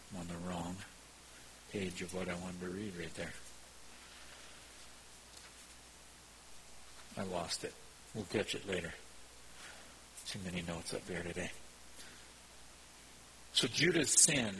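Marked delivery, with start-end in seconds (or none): 0.1-11.5 low, soft aside while searching the notes; quiet aside. Real, not acted. Preacher who lost his page in the notes, quiet aside. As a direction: drop voice and pitch, half to yourself.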